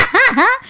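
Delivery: high burst of laughter. Real, not acted. Laughing at the fall.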